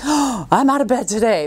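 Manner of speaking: happy, breath-catching burst; excited waking. Acted. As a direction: gasp with delight at being up. Acts out delighted waking up.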